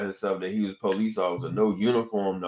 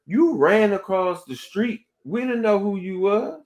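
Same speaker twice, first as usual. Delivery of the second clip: mildly indignant retort, pitched up; retold indignant protest; 9 semitones higher than usual. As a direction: argue back in a raised pitch, stretching it. Retold argument with the officer.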